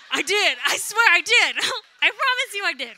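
laughing loudly, swearing it's true; laughing insistence. Real, not acted.

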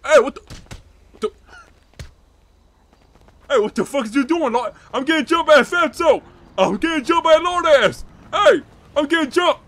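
high, loud panicked yelling; calling for help. Acted. Peanut yells to friends while getting jumped, panicked shouts.

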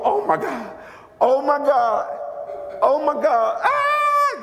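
mock starstruck shriek, rising to a high "ah"; hammed-up fan scream; imitating a screaming fan. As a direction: act out a fan losing it. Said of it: "you know, screaming".

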